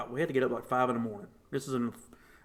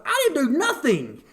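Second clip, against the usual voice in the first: pitched way up in disbelief; high protest; 14 semitones higher, 6 dB louder than usual. Retold or imitated. Son protesting to mom, high and incredulous. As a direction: squeak it out, louder.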